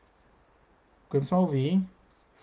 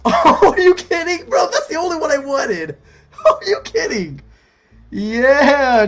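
loud, high whooping excitement; real elated outburst; 9 semitones higher, 10 dB louder, slower than usual. Real, not acted. Reacting to pulling the only card he wanted, elated shouting. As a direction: shout it out, stretched and thrilled, with laughs.

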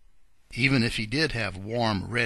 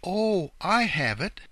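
higher-pitched burst of inspiration; sudden excited cry; 9 semitones higher, 4 dB louder, slower than usual. A narrator's reading. Mother Goose suddenly finding a place to stay, excited cry. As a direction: cry out as the idea strikes. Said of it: "suddenly cried the lady".

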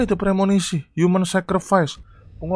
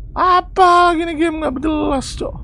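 exasperated; 12 semitones higher, slower than usual. Real, not acted. Fed up with a glitchy game.

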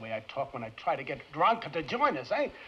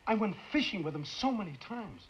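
ordinary talk, nothing marked

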